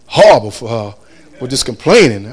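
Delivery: low, soft worried aside to himself; quiet inner thought. Retold or imitated. Husband worries to himself about spoiling the trip, softly. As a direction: say it under your breath, fretting. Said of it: "And I'm saying".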